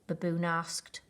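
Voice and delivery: plain reading voice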